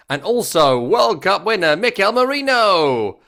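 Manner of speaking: high announcer build-up, slow on the name; stadium announcer. Retold or imitated. Announcer introducing Mikel Merino to the crowd, hyped. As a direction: stretch the player's name high, then let it fall.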